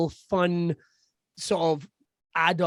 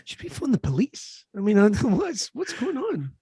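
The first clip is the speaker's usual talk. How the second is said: mock scandalised lady; 6 semitones higher than usual. Mock outraged lady on plane.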